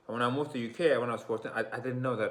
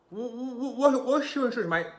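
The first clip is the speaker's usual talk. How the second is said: put-on very high, loud, stuttering British accent; overdone London voice, much higher; 14 semitones higher, 6 dB louder than usual. Exaggerating the UK question in a British accent. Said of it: "I was speaking like this in the accent".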